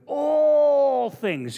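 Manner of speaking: "all things" punched high and loud; stress on all things. Real, not acted. Preacher stresses "all things" to the congregation. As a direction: hit "all things" hard, preacher emphasizing. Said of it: "I emphasize that word".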